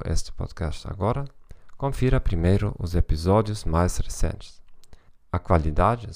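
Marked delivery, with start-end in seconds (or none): none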